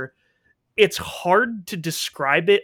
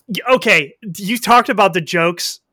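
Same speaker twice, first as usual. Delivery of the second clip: ordinary delivery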